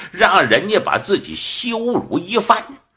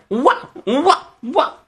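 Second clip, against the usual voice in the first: high imitated dog barks; storyteller's short high woofs; dog barking; 11 semitones higher than usual. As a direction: yap like a dog on all fours. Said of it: "还叫了几声".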